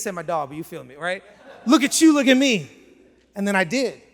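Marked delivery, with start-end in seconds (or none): 1.7-2.7 loud, high imitated taunt; confrontational. Angry train rider sizing up the storyteller, confrontational. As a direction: challenge him loudly to compare.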